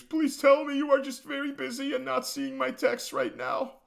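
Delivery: high, mocking voice of an obsessed fan; mock lovesick fan. YouTuber mocking a fan's texts in a high lovesick voice. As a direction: read it as a swooning, pathetic admirer.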